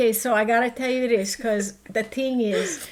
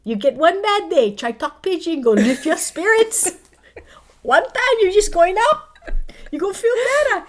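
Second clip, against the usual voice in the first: put-on Pidgin accent; 9 semitones higher, 6 dB louder than usual. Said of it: "I just start talking Pidgin".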